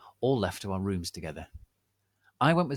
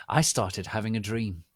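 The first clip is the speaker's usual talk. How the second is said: plain reading voice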